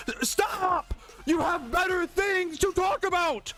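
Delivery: high, strained cry of exasperation; exasperated outburst. Acted. Telling off bean fans, exasperated. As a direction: cut in with a high-pitched, fed-up protest.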